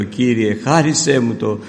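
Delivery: impassioned kneeling prayer, reenacted; retold fervent prayer. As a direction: cry out to God on your knees, retelling it. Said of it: "Και γονατίζω και λέω".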